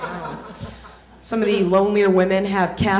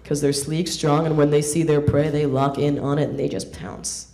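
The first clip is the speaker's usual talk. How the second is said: lower, lightly voiced cocky guy; dudebro voice; 6 semitones lower, 4 dB quieter than usual. Guy bragging to Eliza he'd be a cougar, low cocky voice. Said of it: "It's like".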